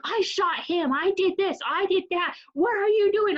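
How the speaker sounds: high, excited mock gamer yelling at the screen. Boyfriend mimicked yelling at his video game, high and excited. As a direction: ham up a boyfriend hyped over his game.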